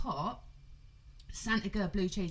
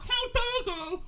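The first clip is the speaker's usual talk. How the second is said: indignant woman; 5 dB louder than usual. Retold or imitated. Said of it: "She was like".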